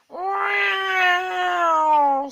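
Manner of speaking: high breathy cat yowl. A narrator's reading. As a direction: yowl like a cornered tomcat. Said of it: "said Scarface Claw".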